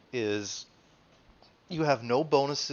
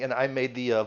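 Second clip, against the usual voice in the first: usual speaking voice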